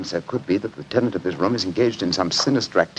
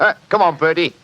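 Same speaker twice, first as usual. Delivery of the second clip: higher-pitched put-on Cockney workman; Cockney accent; 6 semitones higher, 5 dB louder than usual. Bluffing the landlady as a council inspector, Cockney accent. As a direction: play a chatty Cockney building inspector. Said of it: "My Cockney accent".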